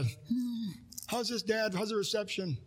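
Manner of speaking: put-on a boy checking the antenna with his father; kid calling to dad.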